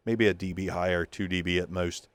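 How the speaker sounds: ordinary talk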